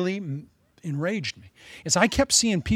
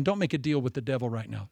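ordinary delivery

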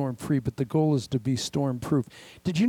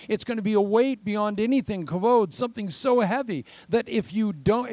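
higher and louder, building sermon cry; fiery preaching; 8 semitones higher, 4 dB louder than usual. Acted. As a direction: preach the coming glory with force. Preaching at full force.

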